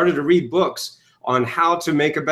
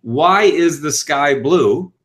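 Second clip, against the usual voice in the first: slow question; 3 dB louder, much slower than usual. Shown as a demonstration. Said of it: "For example, if I were to ask you".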